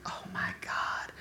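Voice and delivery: breathless scared whisper, slow; hushed, slow retold whisper of fright; frightened whisper. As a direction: gasp it under your breath. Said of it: "I'm like".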